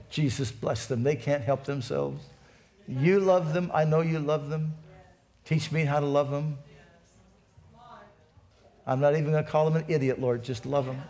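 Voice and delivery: low, soft pleading with Jesus; gentle prayer. Acted. Pastor modelling a prayer for difficult people, gentle prayer. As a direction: pray softly and low for someone else.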